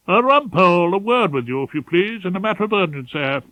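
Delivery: a nervous pompous senior barrister with urgent news; fussy, pompous head of chambers. Acted. Ballard's voice.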